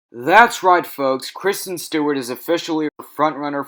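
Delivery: like a game show host revealing the winner; excited announcement. Real, not acted. Vlogger hyping viewers about a frontrunner, loud excited announcement.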